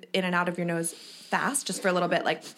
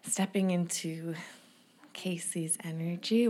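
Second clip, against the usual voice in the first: whispering; 8 dB quieter than usual. Acted. Tarot reader tuning in.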